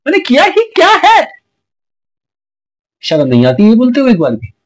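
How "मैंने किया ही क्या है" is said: high, whiny mimicry of her innocent protest; squeaky mock-innocent girlfriend voice; mocking Neha. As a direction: parody her playing innocent, pitched way up. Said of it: "तुम कहती हो".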